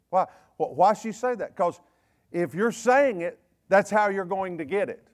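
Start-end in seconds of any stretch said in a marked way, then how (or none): none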